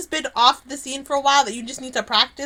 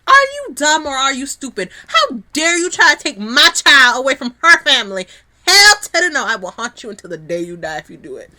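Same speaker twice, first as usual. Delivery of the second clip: furious mother scolding from beyond the grave; outraged telling-off; 2 semitones higher, 10 dB louder than usual. Acted.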